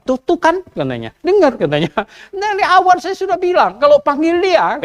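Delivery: told-you-so king. Retold or imitated. Ahab complains to Yosafat, whiny told-you-so. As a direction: complain like a vindicated sulker. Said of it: "Ahab bilang sama Yosafat".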